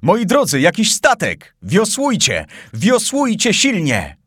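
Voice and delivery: urgent excited call. Acted. Glenarvan urges the rowers, excited urgent call. Urging rowers toward a ship.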